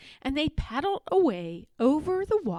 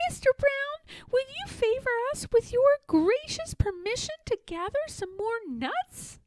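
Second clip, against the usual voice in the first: high, small, very polite squirrel voice. A narrator's reading. Little squirrels politely asking Owl Brown permission, high and sweet. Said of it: "and said".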